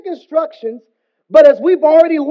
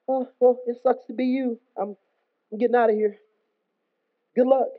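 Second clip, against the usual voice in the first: mock unconcern, softer; indifferent brush-off; 7 dB quieter than usual. Us to people on a breaking ship, indifferent. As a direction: shrug it off quietly and slip away.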